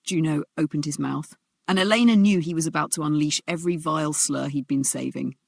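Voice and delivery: ordinary talk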